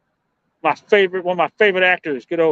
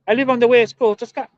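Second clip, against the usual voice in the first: put-on higher-pitched, half-hearted Scottish accent; attempted Scottish accent; 6 semitones higher than usual. Trying to say where they live, attempted Scottish accent. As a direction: have a rough go at a Scottish accent. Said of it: "Scottish accent".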